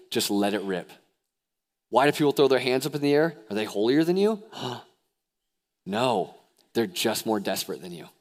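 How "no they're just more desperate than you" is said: ordinary talk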